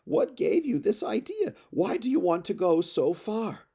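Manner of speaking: high, tearful pleading nurse voice, pitch lifted throughout; an old nurse wailing over her boy; wailing, sobbing plea. Acted. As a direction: beg him to stay as if on the edge of tears. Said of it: "began to wail and sobbed".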